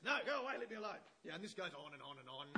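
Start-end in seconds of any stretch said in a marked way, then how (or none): 0.1-1.0 loud, dismissive judge brushing her off; annoyed judge. Retold or imitated. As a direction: wave her away as the fed-up judge. Judge refusing again.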